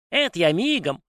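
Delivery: high house spirit voice. A narrator's reading. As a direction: sniff and worry, high-voiced. House spirit eager reply. (высокий голос домового)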